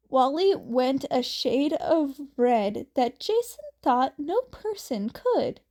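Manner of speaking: plain reading voice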